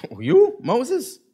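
mock disbelief. Israelite elders greet Moses's return, mock disbelief. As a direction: act out doubters sizing him up.

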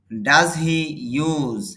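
ordinary talk

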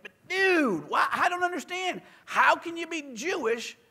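high dude voice, baffled; dude radio host. Retold or imitated. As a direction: ask it like a puzzled rock DJ. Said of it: "he's like".

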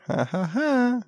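soft, high three-beat chuckle; sly chuckle. Real, not acted. Amused at the priest's sneaky offer, sly soft chuckle. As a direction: chuckle softly at a sneaky trick.

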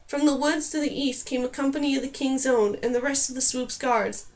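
plain reading voice

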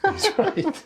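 laughing through the words. Real, not acted. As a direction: breaks into a laugh mid-line. Repeats the line, laughing through it.

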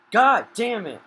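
loud, high angry curse; furious outburst. Real, not acted. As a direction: explode in frustration. Mistake while building in game.

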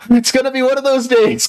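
high, theatrical sigh of doom; dramatic lament. Pretend. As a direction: ham up the bad day.

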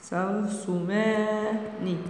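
"ترسمان" softly stretched into syllables; slow dictation. Shown as a demonstration. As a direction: hold "ما" in "ترسمان", then a separate final "ن".

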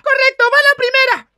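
loud, high, forceful warning; angry announcement. Acted. Mother about to punish the drunk, angry. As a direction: announce the blow like a drill sergeant.

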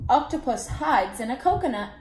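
ordinary delivery

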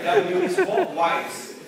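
very high, loud, drawn-out reveal; amused exclamation. Real, not acted. Teacher springs the quiz answer on the class, amused exclamation. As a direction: sing out the answer with a laugh.

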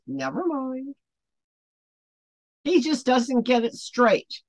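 usual speaking voice

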